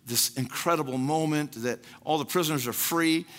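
ordinary talk, nothing marked

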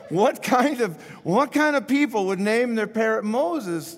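high-pitched, baffled question; incredulous burglar. Acted. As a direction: act out a burglar who can't believe it. Burglar baffled by parrot's name.

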